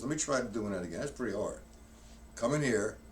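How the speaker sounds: normal talking voice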